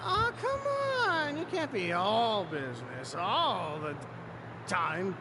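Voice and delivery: put-on loose, tipsy, cheerful drunk. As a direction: sound happily drunk. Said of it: "he acts like a drunk".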